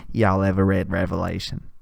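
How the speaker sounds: very low, understated monotone; imitating a low-voiced, deadpan murderer; deadpan killer. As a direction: ask it casually in a low, unbothered voice. Deadpan killer in imagined scene.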